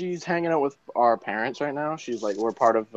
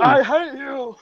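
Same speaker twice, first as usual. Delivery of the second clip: high, intense line reading; dramatic movie quote; 10 semitones higher, 3 dB louder, slower than usual. Acted. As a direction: throw the line like Anakin. Quoting Anakin.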